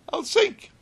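high, loud panicked cry of fear; frightened cry. Retold or imitated. Frightened man in the pool.

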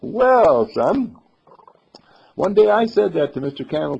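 big, high "well" to a youngster; hearty musician. Acted. Musician confides in the little boy, hearty and loud.

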